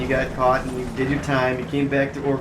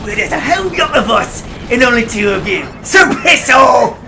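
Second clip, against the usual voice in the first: high, shouting cartoon rat; over-the-top rat voice; 9 semitones higher, 7 dB louder than usual. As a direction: snarl it out like a hammy rat. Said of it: "your most over-the-top rat voice impression".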